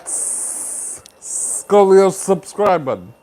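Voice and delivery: long hissed "s-" sliding into "scoliosis"; punning drawn-out S. Real, not acted. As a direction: stretch the S, then punch "scoliosis" for the pun. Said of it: "You see what I did there?".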